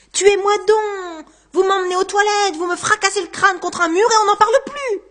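loud, high, overwrought dare; exasperated outburst. Acted. Man daring his tormentor to kill him, exasperated. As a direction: cry out, pushed past all endurance.

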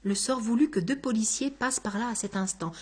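usual speaking voice

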